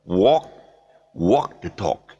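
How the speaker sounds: "walk" as "wawk", no L; tongue-down walk. Shown as a demonstration. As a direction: round "walk" and leave out the L. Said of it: "push your tongue down and say walk".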